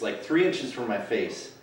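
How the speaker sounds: usual speaking voice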